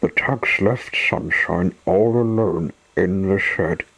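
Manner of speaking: plain reading voice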